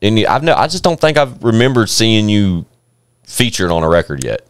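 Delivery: ordinary delivery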